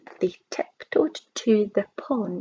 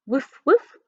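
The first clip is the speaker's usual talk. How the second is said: louder, higher double woof; imitated barking; 4 semitones higher, 4 dB louder than usual. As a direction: bark it out like a dog. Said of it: "let out a deafening sound".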